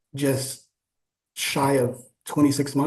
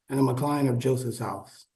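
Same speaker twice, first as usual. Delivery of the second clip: ordinary talk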